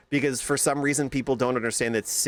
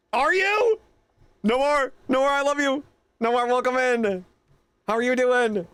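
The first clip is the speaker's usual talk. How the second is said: high, gushing, delighted welcome; excited greeting; 11 semitones higher, 4 dB louder than usual. Real, not acted. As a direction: squeal with joy at a friend arriving. Greeting a friend who just arrived.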